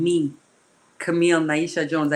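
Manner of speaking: normal talking voice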